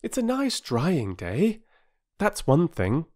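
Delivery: high, measured little-housewife voice; girl feeling grown-up. Acted. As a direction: play a child sounding important and grown-up. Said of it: "feeling very grown up".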